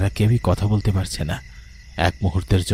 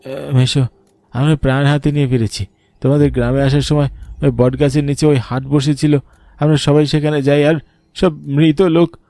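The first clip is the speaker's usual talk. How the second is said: high, louder, agitated voice of a scared youth; shaken retelling; 7 semitones higher, 4 dB louder than usual. Acted. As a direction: tell the uncle breathlessly what they saw. Bhupen tells uncle of terror.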